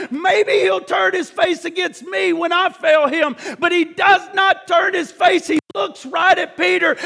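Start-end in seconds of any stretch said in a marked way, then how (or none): none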